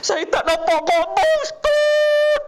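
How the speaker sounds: high, loud wailing cry. Retold or imitated. Old pilgrim wailing to the ustaz that he can't see the Kaaba. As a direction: wail it like a weeping old man. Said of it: "dia menangis".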